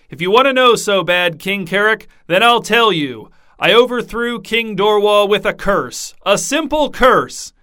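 king boasting to his guests, loud and gleeful; jovial king. A narrator's reading. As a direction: relish the reveal, drawing out the end. Merry king reveals curse.

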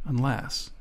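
unless, dropped to a near-silent murmur; very quiet. A narrator's reading. Grant quietly hinting to himself, barely audible. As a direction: say it under your breath so the man can't hear. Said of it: "But he said "unless" very quietly".

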